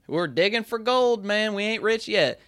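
put-on Southern accent; sudden Southern accent. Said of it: "I said it just like that".

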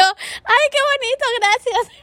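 high sweet fake gratitude; fake happy thanks. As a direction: pretend to be touched, voice lifted high. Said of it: "Y yo". (agradecimiento falso, agudo y dulce)

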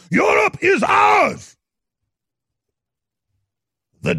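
shouting, slow menacing cleric voice; Imam of Jerusalem impression. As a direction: threaten like a ranting cleric. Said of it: "Like the Imam of Jerusalem".

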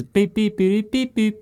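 high beeping tones; imitated communicator beeps. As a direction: beep like a Power Rangers communicator. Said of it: "And Power Rangers".

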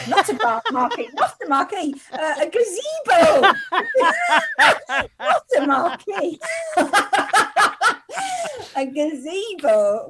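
laughing through a self-correction; high, loud, cracking up at your own slip; words breaking into real laughter; helpless laughing. Real, not acted. Laughing at own word mistake.

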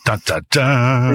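slow, mock suspense sting; dramatic dun dun dun. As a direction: drag out a game-show sting.

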